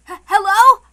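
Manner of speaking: put-on a young man caught off guard by a call; startled young man. Battler startled by Kyrie's call.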